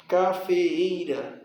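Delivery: slow "cafeeira" with both Es distinct; separated vowels. Shown as a demonstration. Teacher showing these words always separate, slow divided "cafeeira". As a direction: stretch "cafeeira" syllable by syllable. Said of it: "essas palavras sempre se separam".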